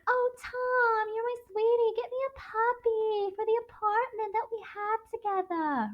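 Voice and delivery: very high-pitched, cooing sweetheart voice; hammed-up wheedling in a high New York voice; Myrtle. Mistress begging her lover for a puppy, mock high wheedling. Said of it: "Myrtle's like".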